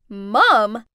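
loud disbelieving shout; stunned group cry of disbelief; shocked shout. Acted. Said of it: "all shouted".

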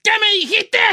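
King Kong challenge. Pretend. Daring Alfredo to come out, shouting like King Kong. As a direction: bellow at him to come out and fight. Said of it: "Así como King Kong".

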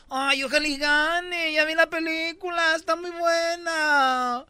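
high, slow, affected society-lady voice; imitating Laura Zapata. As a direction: play a snooty rich lady, slow and sing-song. (voz de señora fresa, aguda y lenta)

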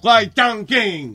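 slow, drawn out Huay Chang King; hammed-up mock name; mock Chinese name. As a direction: say it slowly as a made-up name.

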